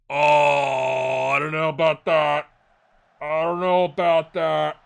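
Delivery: loud, drawn-out doubting; doubtful. Real, not acted. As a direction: question the call out loud.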